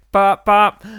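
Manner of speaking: loud, high, vocal boop noise; bop bop sound effect. Pretend. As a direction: pop out two bouncy bops.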